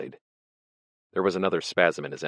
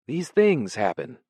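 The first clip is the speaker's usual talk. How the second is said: high and slow, drifting off; vague musing; 7 semitones higher, 4 dB louder, slower than usual. Acted. Ampleforth begins his confession to Winston, slowly and vaguely. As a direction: muse it half to yourself. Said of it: "he began vaguely".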